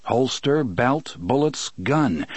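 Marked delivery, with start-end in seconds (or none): none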